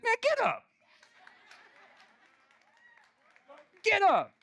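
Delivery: sudden very high-pitched outburst; exasperated command. Acted. Jesus to the man by the pool, exasperated command. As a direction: cut him off with a high-pitched order. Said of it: "that's how I heard it".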